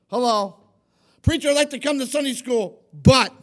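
loud mocking voice of a reluctant churchgoer; excuse maker. Preacher mimicking a congregant's excuses, loud and mocking. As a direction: hammer each excuse and its but.

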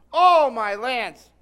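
loud, slow cry of pain; drawn-out loud oh of hurt; pained exclamation. Retold or imitated. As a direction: relive the moment the drawer caught you. Preacher relives smashing his finger.

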